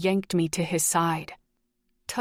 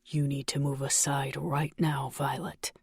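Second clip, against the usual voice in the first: lower, softer, ice-cold menace; chilling cold tone; 4 semitones lower, 4 dB quieter than usual. A narrator's reading. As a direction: threaten her quietly like a killer. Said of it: "in a tone that cooled my blood".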